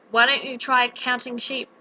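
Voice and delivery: normal talking voice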